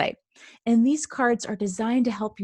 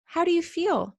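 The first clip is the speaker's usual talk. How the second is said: put-on 1 caring kid voice; 8 semitones higher than usual. Classmate asks about feelings.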